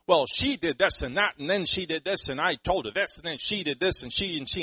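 hammed-up griping husband, loud and high; complaining husband. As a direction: rattle off her faults in a mock whine. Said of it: "The man's gonna sit there and say".

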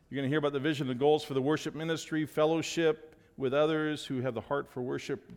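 ordinary talk

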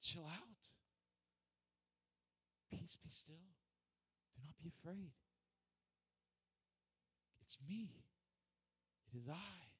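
soft, unhurried, with long pauses; calming reassurance. Acted. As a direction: soothe someone frightened, gently. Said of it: "And isn't Jesus saying".

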